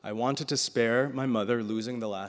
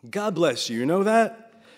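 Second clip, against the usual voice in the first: heartfelt blessing; 8 semitones higher, 7 dB louder than usual. Acted. Grandfather's emotional outburst.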